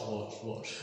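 echo voice. Pretend. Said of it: "add echo to your echo".

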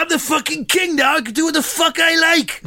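high, indignant royal outburst; King Charles impression. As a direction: pull rank, high and huffy. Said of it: "proper impression".

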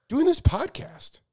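talking through a yawn; words stretched by a yawn. Real, not acted. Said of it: "a giant yawn right in my face".

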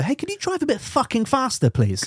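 high-pitched pleading complaint; mock exasperation. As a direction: beg in exaggerated exasperation. Acted exasperated team radio request.

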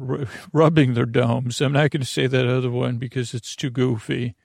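normal talking voice

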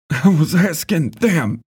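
high, pained shout. A narrator's reading. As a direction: yell through the pain. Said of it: "Ben shouted".

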